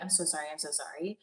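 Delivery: very soft, quick, low apology; meek apology. Retold or imitated. Imitating movie character's apology, meek and hushed. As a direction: rush the apology out under your breath. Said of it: "she apologizes the same way I do".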